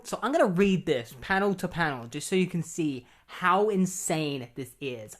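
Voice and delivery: normal talking voice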